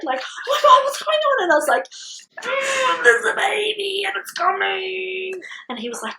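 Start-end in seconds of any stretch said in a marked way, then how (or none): 0.2-1.4 high, loud, alarmed yelp; startled awake. Retold or imitated. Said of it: "jumps out of bed". 2.4-5.3 high, strained wail through a contraction; mock labour wail. Acting herself in labour, waking partner.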